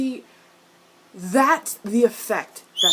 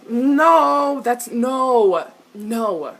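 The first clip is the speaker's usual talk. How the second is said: raised-voice disbelieving refusal; emphatic objection; 3 semitones higher, 8 dB louder, much slower than usual. Real, not acted. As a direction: protest loudly, refusing to accept it. Rejecting a line, upset.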